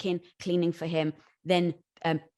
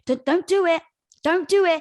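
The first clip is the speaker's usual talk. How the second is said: higher, loud pleading warning; urgent warning; 8 semitones higher, 8 dB louder than usual. Retold or imitated. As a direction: stop a friend from jumping. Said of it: "trying to protect you to say".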